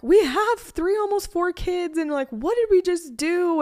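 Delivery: high, loud, slowed panicked wail; retold panic. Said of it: "I've had meltdown after meltdown of".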